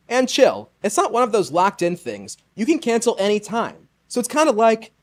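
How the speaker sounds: ad read. Acted. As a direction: pitch the product like a friendly host ad. Sponsor ad read.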